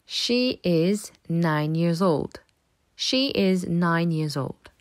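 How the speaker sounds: put-on slow, clear "She is nine years old"; slow model sentence. Shown as a demonstration. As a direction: say it slowly so pupils repeat. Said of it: "Répète après moi". (phrase modèle lente)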